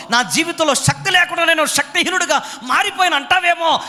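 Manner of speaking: high, driving pulpit voice; fiery preaching. Acted. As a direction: preach it hard to the congregation. Preaching at full force. (ఉత్సాహంగా బోధించడం)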